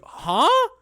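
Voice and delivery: startled huh. Retold or imitated. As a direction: yelp it, caught off guard. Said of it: "I was like".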